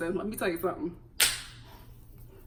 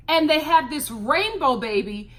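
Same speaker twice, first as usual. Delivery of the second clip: ordinary delivery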